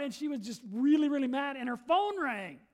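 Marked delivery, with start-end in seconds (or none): none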